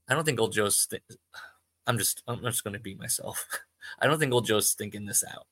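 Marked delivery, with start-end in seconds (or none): none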